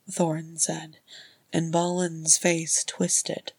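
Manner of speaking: ordinary delivery, nothing marked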